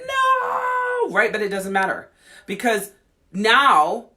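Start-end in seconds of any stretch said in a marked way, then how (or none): 0.1-1.1 mock protest. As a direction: wail a long refusal.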